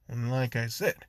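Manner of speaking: plain reading voice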